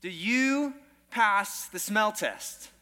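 normal talking voice

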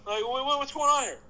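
high, mock-innocent questions to the ref; mock indignant player. Fan retelling a player's words to the ref, mock innocent. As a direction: play it up as a wide-eyed wronged player. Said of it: "He goes".

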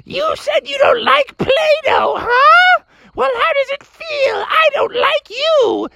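very high, slow villain snake voice; menacing python. Acted. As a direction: taunt your prey in a squealing, drawn-out creature voice. Toy python menaces Froggy.

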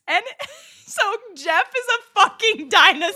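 giggling through the reveal; laughing at the punchline. Real, not acted. Cracking up at the twist.

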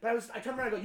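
usual speaking voice

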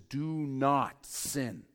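very slow, each word weighted; slow emphatic command. Acted. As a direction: spell out the command slowly. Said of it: "Paul says".

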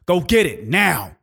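forceful parental order, imitated; stern dad. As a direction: act out a father ordering his kid. Stern dad ordering his child.

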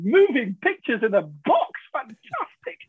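slow, wide-eyed wonder at the TV; awestruck. Retold or imitated. Telling Kenny, stunned by the plasma TV, awestruck. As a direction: marvel like a man who hasn't seen TV in weeks. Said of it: "I'm going".

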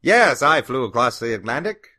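put-on old-time radio announcer voice; hint of a vintage newsreel hero; old-timey voice. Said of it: "he had one of those old-timey voices".

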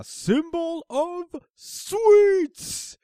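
booming, very drawn-out heroic announcement; hammed-up superhero catchphrase, huge and slow; All Might impression. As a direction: stretch each word and blast the last one loud. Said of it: "Can you say it, please, Mister Comedian".

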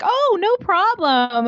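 high, slow polite reassurance; lilting Canadian politeness. Retold or imitated. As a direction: sweetly brush it off. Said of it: "the polite thing to do is be like".

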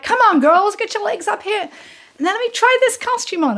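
high showbiz star impression. Star urging dancers into high kicks, imitated high. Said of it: "high kicks and like".

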